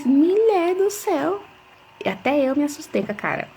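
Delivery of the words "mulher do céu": much higher, slow, drawn-out amazement; astonished exclamation. Retold or imitated. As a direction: exclaim slowly in shock at what you see. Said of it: "até eu falei". (espanto lento e bem agudo)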